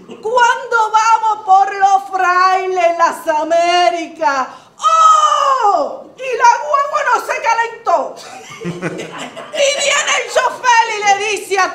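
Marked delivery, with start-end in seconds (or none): 4.8-6.1 a high, loud crying whoop; high shouted oh. Acted. Comic storyteller whooping to the audience, loud high shout. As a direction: throw a big whooping oh to the room.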